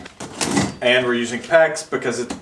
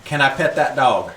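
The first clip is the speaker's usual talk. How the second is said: demonstrating a Southern English phrase; Southern accent. Shown as a demonstration. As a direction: model a Southern accent for someone to copy. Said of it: "say some phrases in Southern".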